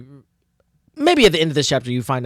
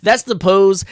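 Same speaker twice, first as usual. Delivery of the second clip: ordinary talk, nothing marked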